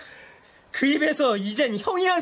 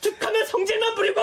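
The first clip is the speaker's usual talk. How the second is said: higher, shouted accusation; angry shout; 7 semitones higher than usual. Acted. Younger brother shouting at his stepbrother in a fight, angry shout. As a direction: yell the insult in his face.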